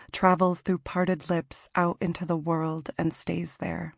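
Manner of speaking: ordinary delivery, nothing marked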